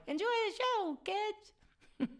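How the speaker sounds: high, loud squeaky cartoon voice; Mickey Mouse. Cartoon mouse sending kids into the show, squeaky impression. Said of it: "my best Mickey Mouse".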